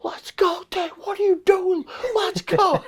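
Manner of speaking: high, strained imitation of a scream; Judd Logan screaming. Huge coach yelling at thrower from behind the circle, mock scream. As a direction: mimic a yelled urging in a squeezed, very high voice. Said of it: "just start screaming".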